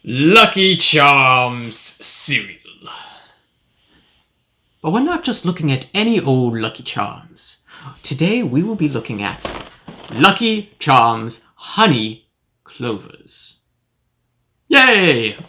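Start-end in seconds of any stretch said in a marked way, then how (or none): 0.0-2.6 slow, drawn-out product reveal; grand announcement. Pretend. 14.7-15.4 high, drawn-out little cheer; mock cheer. Cheering the cereal reveal.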